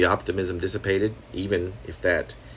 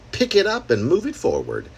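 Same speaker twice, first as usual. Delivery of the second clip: louder and higher on the title; a little louder; 10 semitones higher, 3 dB louder than usual. Real, not acted. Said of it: "I'm saying that a little louder than normal".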